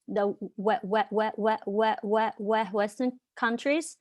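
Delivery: repeats we- several times; stuttering. Real, not acted. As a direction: gets stuck before Western. Speaker who stutters stuck on word.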